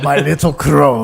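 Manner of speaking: loud, slow, drawn-out character impression; Tormund. Hailing Jon on arrival at Winterfell, loud slow put-on voice. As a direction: boom it out slowly in a put-on voice. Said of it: "and then Tormund tackles him".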